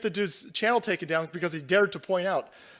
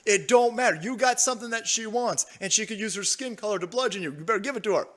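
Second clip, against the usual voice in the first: normal talking voice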